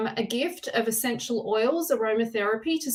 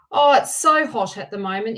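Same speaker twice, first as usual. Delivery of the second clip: ordinary talk, nothing marked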